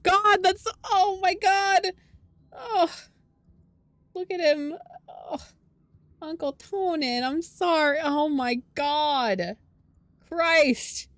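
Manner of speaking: high, slow, near-tearful cries of dismay; real distress. Real, not acted. Player reacting to grieving Uncle Tonin, near tears. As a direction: voice cracking upward in real sorrow.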